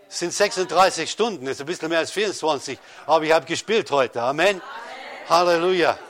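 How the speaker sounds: loud, exultant preaching with a laugh; joyful testimony. Real, not acted. Preacher telling the church about playing piano again, exultant. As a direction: celebrate the healed finger, loud and triumphant.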